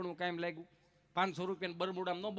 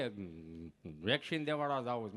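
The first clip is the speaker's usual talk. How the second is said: low, halting stammer; imitating a tongue-tied man; stammering friend; 9 semitones lower than usual. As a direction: stick on the words, tongue catching. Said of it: "જીભ જલાય, ચોંટે અટકે".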